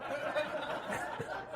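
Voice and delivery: high, breathy laugh; wheezy laugh; airless giggle. Real, not acted. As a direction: crack up at the voice. Laughing at own Lincoln voice.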